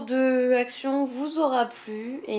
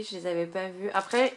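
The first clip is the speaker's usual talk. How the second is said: normal talking voice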